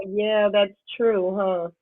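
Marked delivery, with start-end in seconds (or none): none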